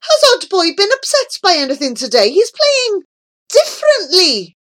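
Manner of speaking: high, loud, agitated calling. A narrator's reading. Old man asking his grandson, agitated loud calling. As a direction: call out in rising worry. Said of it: "the old man called out".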